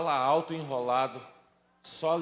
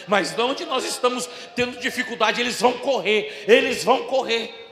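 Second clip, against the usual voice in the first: fervent preacher rallying the church, louder and higher; impassioned preaching; 4 semitones higher, 8 dB louder than usual. Real, not acted. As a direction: build from raised voice to a full shout.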